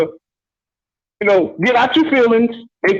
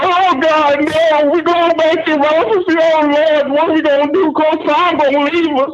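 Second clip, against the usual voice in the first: higher, wailing, slowed mock despair; mock panicked fan; 5 semitones higher, slower than usual. Caller mocking worried fans, hammed-up wailing. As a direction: whine like a fan who thinks the world just ended. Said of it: "up here like, like somebody died".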